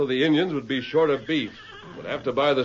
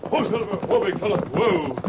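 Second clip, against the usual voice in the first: calling to horse; 7 semitones higher than usual. Acted. Calming the horse.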